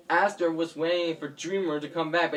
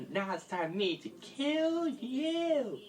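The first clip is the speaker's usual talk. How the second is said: slow, high, soft singsong threat; gloating villain; 8 semitones higher, 9 dB quieter than usual. Acted. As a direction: draw out the threat softly, up high. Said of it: "Graciana said".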